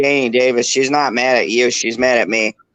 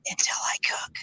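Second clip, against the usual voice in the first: very soft whisper; whispered aside; 13 dB quieter than usual. Real, not acted. Streamer undercutting his own defence in a whisper. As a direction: drop right down to a whisper.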